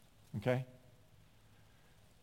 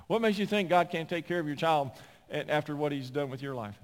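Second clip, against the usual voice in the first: ordinary talk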